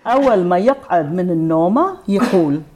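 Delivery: quick fluent Arabic reading; reading Arabic aloud. Shown as a demonstration. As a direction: read the Arabic line briskly. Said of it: "okay, I'll read it".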